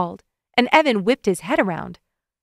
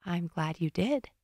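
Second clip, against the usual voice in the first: slow, softer woman's murmur; soft and intimate; 2 semitones lower, 10 dB quieter, slower than usual. A narrator's reading. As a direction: say it close and tender. Intimate moment, kissing.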